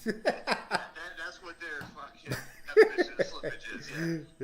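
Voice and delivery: laughing hard and loud through the words; cracking up at his own joke. Real, not acted. Joking with his host about fees, laughing loudly.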